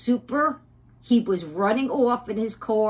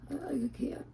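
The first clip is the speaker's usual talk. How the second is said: whispered, voice dropping away; can barely get the words out; 3 semitones higher, 13 dB quieter than usual. Real, not acted. To Mickey, a whispered admission she can't go on. Breaks off showing the apartment.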